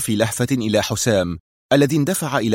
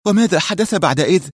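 higher female voice, anxious; anxious Mona; 8 semitones higher than usual. A narrator's reading. Mona anxiously asks what happened next, higher voice. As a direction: heart pounding, ask. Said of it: "خفق قلب منى في قوة".

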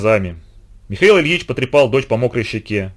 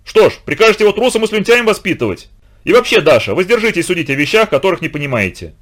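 angry retort; 6 semitones higher, 3 dB louder than usual. A narrator's reading. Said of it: "яростно грохнул стулом".

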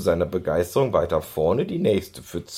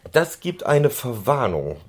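bellowing; 6 semitones higher than usual. A narrator's reading. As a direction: bark out a warning. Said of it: "brüllte eine Männerstimme".

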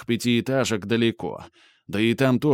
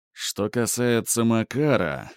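ordinary delivery, nothing marked

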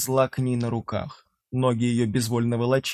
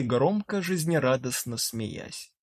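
plain reading voice

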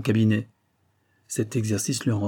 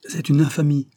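vehement; 5 semitones higher, 5 dB louder than usual. A narrator's reading. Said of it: "avec plus de véhémence".

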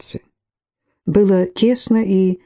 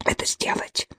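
1 whispered demand; 10 dB quieter than usual. A narrator's reading. Wife's demand trails to whisper.

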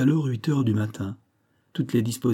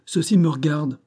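higher, firm insistence; raised, insistent tone; insistent claim; 6 semitones higher, 3 dB louder than usual. A narrator's reading. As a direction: firmly overrule a subordinate. Hod overrules Fox after crying out.

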